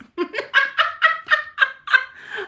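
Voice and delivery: loud high giggling fit; high burst of laughter. Real, not acted. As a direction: let the laugh break out high. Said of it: "I'm laughing".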